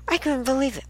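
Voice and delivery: high, quick run-through in disbelief; exasperated disbelief. Real, not acted. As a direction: rattle it off high, with COULDN'T run together. Disbelief at endless reminder calls.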